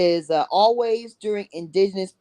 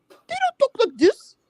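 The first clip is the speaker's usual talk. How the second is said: much higher, hammed-up squeaky mock voice; exaggerated mock voice. Shown as a demonstration. Host mocks newcomers' speech in exaggerated falsetto. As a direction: push the mockery way up into a falsetto. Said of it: "they have dropped they mamas".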